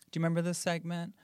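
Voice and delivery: ordinary talk, nothing marked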